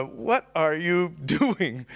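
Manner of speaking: slow, amused taunt broken by a laugh; mock his other self while chuckling; laughing mockery. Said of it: "and he's laughing".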